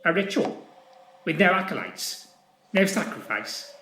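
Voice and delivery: lightly sneering officer; mock it with a sneer. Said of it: "a sneer playing round his lips".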